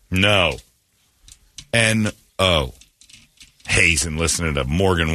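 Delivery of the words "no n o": ordinary talk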